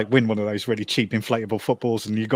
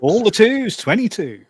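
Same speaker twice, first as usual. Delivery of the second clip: bingo caller; 9 semitones higher, slower than usual. Retold or imitated. Doing bingo calls.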